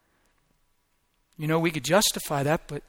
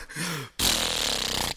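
deep overwhelmed gasp; 5 dB quieter than usual. Real, not acted. I was done.

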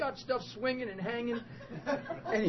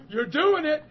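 loud, raised cheer over his protest; excited encouragement; 7 semitones higher, 7 dB louder than usual. Retold or imitated. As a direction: insist on it, cheering him on loudly. Cheering the healed man on.

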